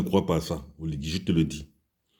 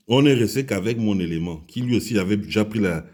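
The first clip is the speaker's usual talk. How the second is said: ordinary delivery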